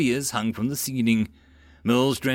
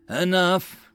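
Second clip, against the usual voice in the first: snapped; 7 semitones higher than usual. A narrator's reading. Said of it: "Judas snapped".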